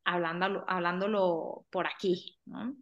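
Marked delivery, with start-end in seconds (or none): none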